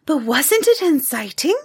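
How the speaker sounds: put-on a bright child's eager question; a hint of a young girl's voice; little girl Taffy.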